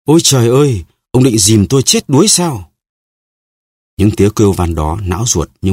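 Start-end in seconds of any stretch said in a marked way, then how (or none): 0.0-2.7 higher, frightened shriek; Milady shrieks as the executioner carries her off; terrified wail. A narrator's reading. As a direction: cry out in terror of drowning. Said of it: "Mụ kêu gào".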